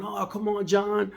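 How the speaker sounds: retold angry customer, pitch way up; mouthing off. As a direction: nag like a fed-up customer in the chair. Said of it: "He started mouthing off".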